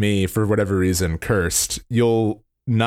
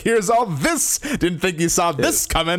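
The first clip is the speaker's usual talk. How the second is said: hyped company voice; 8 semitones higher than usual. Retold or imitated. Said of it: "they're like".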